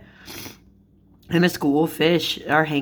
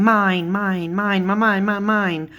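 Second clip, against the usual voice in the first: higher, repetitive bird-like chant; Finding Nemo seagulls; 3 semitones higher than usual. Retold or imitated. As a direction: chant it like greedy cartoon gulls. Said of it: "seagulls. They're trying to go after them".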